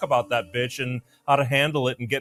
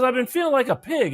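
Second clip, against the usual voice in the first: whiny patient; 9 semitones higher than usual. Acted. Joke, patient voiced high.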